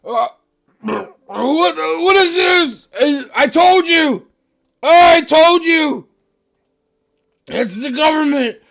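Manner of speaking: put-on loud, slow panicked drunk shouting; town drunk. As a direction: yell like a paranoid drunk. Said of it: "the town drunk wakes up".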